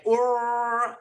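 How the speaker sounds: "or" held long and high with a heavy r; overdone imitation accent on "or"; exaggerated "or". Shown as a demonstration. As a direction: stretch out "or" as a mock-Aussie vowel. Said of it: "So everything's with an exaggerated".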